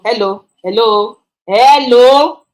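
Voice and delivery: a loud, sharp hello; irritated hello. Shown as a demonstration. Showing how an annoyed hello sounds to customers. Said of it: "the way you say it has to do with how you are feeling".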